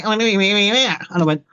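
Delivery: mumbled Liverpool twang. Retold or imitated. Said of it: "the Liverpudlian twang".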